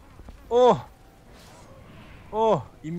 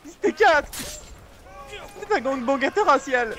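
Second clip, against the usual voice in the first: outraged shout; 8 semitones higher, slower than usual. Real, not acted. Outraged at being ganged.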